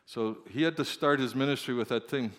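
ordinary delivery

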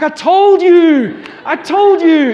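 slow, loud exasperated scolding; exasperated I told you. Retold or imitated. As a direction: drag it out like a fed-up dad. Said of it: "I'm like".

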